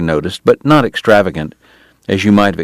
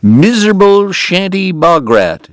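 relishing mimicry; 11 semitones higher, 4 dB louder than usual. Eddie recites it with relish.

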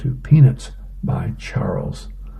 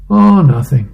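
loud, higher, put-upon "oh, nothing"; pointed nothing; 6 semitones higher, 9 dB louder than usual. Acted. Wife on the couch answers her husband, pointedly. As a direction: voice a wife insisting loudly it's nothing.

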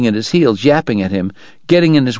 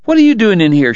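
high, flustered woman's demand; 11 semitones higher than usual. A narrator's reading. Kate to Doc after her fall, flustered and demanding. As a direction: pitch it way up and demand an answer. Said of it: "she demanded".

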